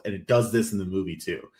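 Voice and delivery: ordinary talk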